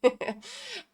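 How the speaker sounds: high warm giggle; short delighted laugh; fond laugh. Real, not acted. Laughing at child's answer.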